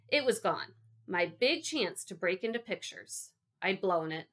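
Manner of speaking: ordinary delivery, nothing marked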